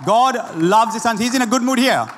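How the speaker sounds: ordinary talk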